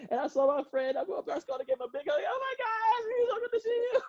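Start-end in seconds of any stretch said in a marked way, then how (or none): 2.2-4.0 very high excited greeting; high-pitched gushing hello; gushing expressive. Retold or imitated. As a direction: squeal it like meeting a dear friend. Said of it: "have you ever met somebody that talk like".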